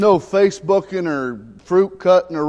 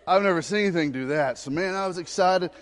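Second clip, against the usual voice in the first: ordinary delivery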